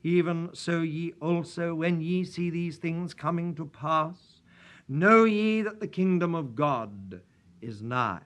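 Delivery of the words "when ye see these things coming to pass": ordinary talk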